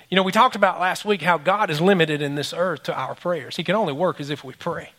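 ordinary talk

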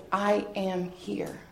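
very slow, soft voice of God; calm reassurance. Retold or imitated. God answers the worried believer, calm reassurance. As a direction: settle the panic with slow, quiet words.

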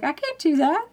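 retold refusal with a questioning lift; balking. Customer answering the voice in her head, balking.

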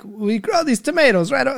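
a waiter proud of his tomatoes, in hammed-up Italian accent; bad Italian accent. Retelling the Italian waiters on his honeymoon, mock Italian accent. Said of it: "this is a really great Italian accent I'm doing".

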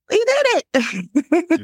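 high, slow, drawn-out delight; proud parent. Retold or imitated. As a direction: savour it like a beaming parent. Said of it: "a proud parent moment".

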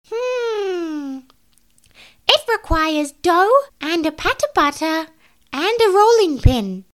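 put-on a bossy old lady rat weighing it up; old woman rat voice. A narrator's reading. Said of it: "said Anna Maria".

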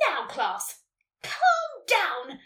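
rising to a loud, high order; angry teacher. A narrator's reading. Teacher settling a rowdy class, angrily. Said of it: "said angrily".

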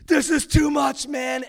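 slow, high, loud mock cry of protest; hammed-up shout of a man breaking down; overwhelmed outburst. Imitates man overwhelmed, about to cry.

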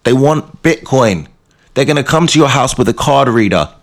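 ordinary talk

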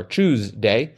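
"Tuesday" slowly, starting with ch; Tuesday as CHOOSE-day. Shown as a demonstration. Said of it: "pronounced as a soft ch sound".

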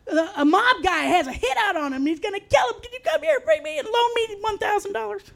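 high, wheedling imitation of her; girlfriend's plea. Girlfriend asking for money over the phone, high wheedling voice. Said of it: "she was like".

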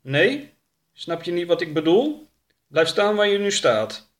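impatient armed questioner; stern older man. Acted. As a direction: demand identification, loud and insistent.

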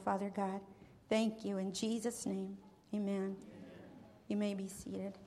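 ordinary delivery

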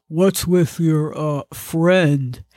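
firm, slow police officer; stern cop voice. Acted. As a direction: question him like a cop. Officer character voice.

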